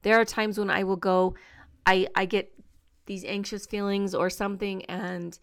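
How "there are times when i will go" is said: ordinary talk, nothing marked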